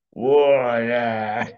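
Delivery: lusty, drawn-out pirate cheer; imitated pirate, loud swelling start; pirate character. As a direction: roar it like a rogue, then ease off. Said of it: "like this pirate character".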